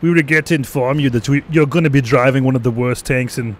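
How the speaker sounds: hammed-up German officer delivering bad news; failed attempt at a German accent. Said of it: "I can't do German".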